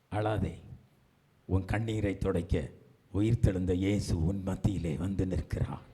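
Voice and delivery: soft, low consoling voice; tender comfort. Real, not acted. Preacher tells the weeping not to cry, tender comfort. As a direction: hush the voice to soothe.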